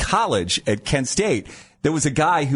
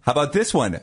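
ordinary delivery

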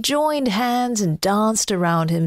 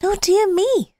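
put-on little boy voice; 10 semitones higher, 3 dB louder than usual. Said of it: "said the little boy".

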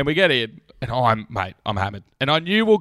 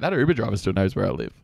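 worried inner thought; 9 semitones lower than usual. Retold or imitated. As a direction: mutter it like a nagging worry. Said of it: "I'm like".

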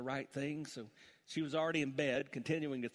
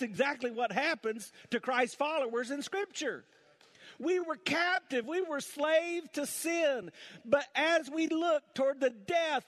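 loud, high, driving sermon climax; preaching at full force; 11 semitones higher, 6 dB louder than usual. Acted. Pastor preaching the gospel to the congregation, full force. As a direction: build it up and proclaim to the church.

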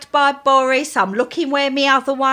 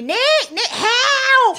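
mock shout; 10 semitones higher, much slower than usual. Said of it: "One point you were shouting".

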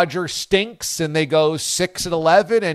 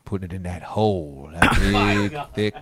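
low, slow, hushed Southern drawl; drawn-out low Southern voice, close to the mic; southern drawl pillow talk; 4 semitones lower than usual. Pretend. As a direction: purr it like a sultry Southern pillow talk host. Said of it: "Southern draws are fun".

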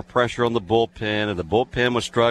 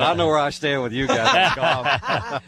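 laughing through mock hurt, a bit higher; chuckling while protesting, slower at the end; 5 semitones higher than usual. Real, not acted. Old player laughs at hosts for dropping him, laughing. Ribbing about golf snub.

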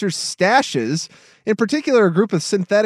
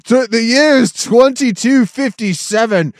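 mock documentary narration.

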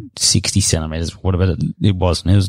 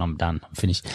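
softer, dropped, final I'm finished; quiet resigned refusal; 2 semitones lower, 9 dB quieter than usual. Retold or imitated. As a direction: let it fall away, done with it. Refusing to swim back out.

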